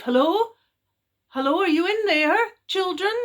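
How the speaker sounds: loud high calls; shouting into cave. A narrator's reading. Parents shouting into the cave for their children, loud. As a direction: call through the boulder. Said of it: "they shouted through into the cave".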